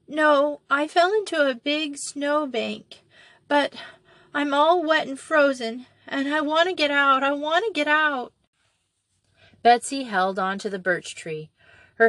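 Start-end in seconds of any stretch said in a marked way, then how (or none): 0.1-8.3 put-on a lost child answering through tears; sobbing little girl. A narrator's reading. Molly answers Betsy from the pit, sobbing.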